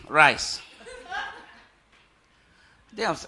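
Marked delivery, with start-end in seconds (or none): 0.1-0.6 low one-word reply; bad-mood wife. Wife in a bad mood asked what she ate, curt. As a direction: answer curtly, offering nothing more. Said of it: "my wife is not in a good mood".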